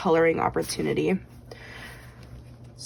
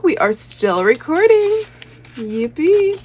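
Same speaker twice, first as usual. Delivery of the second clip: excited; 8 semitones higher, 6 dB louder, slower than usual. Real, not acted. Cheering that recording continues.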